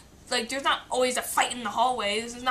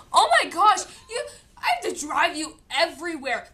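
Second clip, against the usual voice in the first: high, loud, whiny mom voice; mock mom's overwhelmed complaint; hammed-up fed-up mother; exasperated mom; 4 semitones higher, 5 dB louder than usual. Mom complaining about driving.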